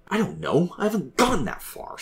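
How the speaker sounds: high-pitched, irritated retort; angry. A narrator's reading. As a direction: snap back defensively, voice jumping up. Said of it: "Sam said angrily".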